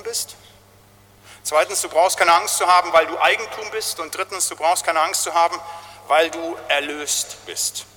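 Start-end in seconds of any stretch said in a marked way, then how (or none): none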